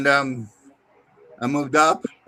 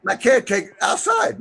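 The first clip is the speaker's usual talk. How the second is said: shouting; 9 semitones higher than usual. Real, not acted. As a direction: shout it across the room. Shouting answer across room.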